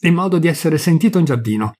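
usual speaking voice

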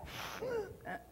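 hammed-up high forced sigh; exaggerated Godzilla-style exhale; client's forceful breath, mock high sigh; mock huffing sigh. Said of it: "I'm like".

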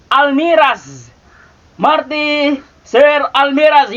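ordinary delivery

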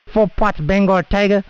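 ordinary talk, nothing marked

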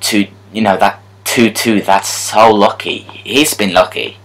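usual speaking voice

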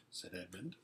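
usual speaking voice

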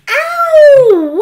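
high, loud wail of pain; little brother wailing after a pinch. Acted. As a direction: yelp it like a tattletale. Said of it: "wailed Peter".

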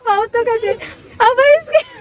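high teasing through real laughter; laughing mock accusation. Real, not acted. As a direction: giggle out the tease, pitched well up. Laughing at scared papa.

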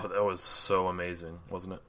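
low, quiet sarcastic praise; understated mock admiration. Real, not acted. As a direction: praise it softly, not meaning a word. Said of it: "I know you're being sarcastic".

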